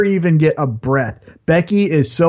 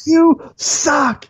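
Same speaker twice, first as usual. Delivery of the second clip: mock heel taunt; 10 semitones higher than usual. Becky taunting during attack.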